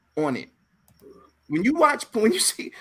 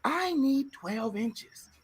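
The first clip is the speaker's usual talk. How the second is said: slow, soft, sultry woman's line; woman's demand; 5 semitones higher, 7 dB quieter, much slower than usual. Retold or imitated. Shari Hadley character demanding twelve inches, slow and soft. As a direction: purr it slowly. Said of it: "She says".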